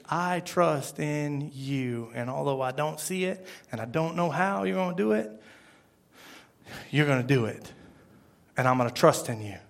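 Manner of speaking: soft, low, sincere prayer; quiet trust. Acted. Believer telling God he will trust, hushed and sincere.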